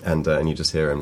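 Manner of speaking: usual speaking voice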